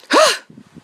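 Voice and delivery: sharp, loud theatrical gasp; shocked gasp. Acted. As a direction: gasp in outrage at what you just heard. Villainess gasps at betrayal in story.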